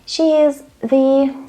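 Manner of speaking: slow, THE held as "thee"; hesitation on THE. Shown as a demonstration. Teacher demonstrating not being sure, hesitant "thee" for THE. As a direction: stall on THE, searching for what follows. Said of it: "This is a hesitation".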